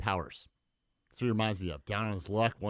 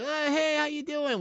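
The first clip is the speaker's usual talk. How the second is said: oblivious Daniel; slower than usual. Retold or imitated.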